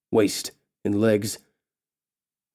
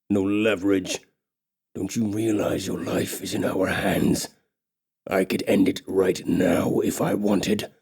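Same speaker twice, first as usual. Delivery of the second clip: threatening orc king; 3 semitones lower than usual. Acted. Orc king threatening the kneeling elf, low and menacing. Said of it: "tells Solana rapidly".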